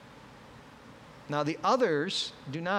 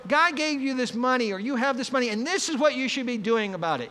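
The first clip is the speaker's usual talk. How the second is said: bossy advice; 7 semitones higher, 4 dB louder, faster than usual. Retold or imitated. Mocking a busybody's advice.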